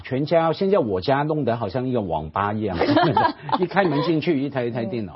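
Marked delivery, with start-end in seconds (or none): 2.8-3.5 short high burst of laughter. Real, not acted. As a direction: laugh out loud. Laughs at own story.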